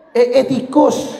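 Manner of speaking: high, slow startled squeal at a mouse. Retold or imitated. Worshipper spots a mouse mid-prayer, high startled squeal. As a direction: squeak out the fright, laughing.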